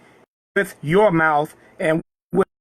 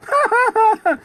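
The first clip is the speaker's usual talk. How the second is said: very high rapid laugh. Real, not acted. Preacher laughs after telling Moses his enemies died, high chuckle. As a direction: break into a high-pitched chuckle.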